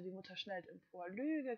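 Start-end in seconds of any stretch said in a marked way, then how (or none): 1.1-1.6 a high, loud shriek of "Lüge". A narrator's reading. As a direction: hurl the word out as a scream. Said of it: "kreischt sie".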